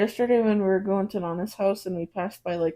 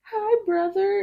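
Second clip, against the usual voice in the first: put-on pitched-up little-kid hello; imitating toddler greeting; 12 semitones higher, much slower than usual. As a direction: greet a baby sibling like a toddler.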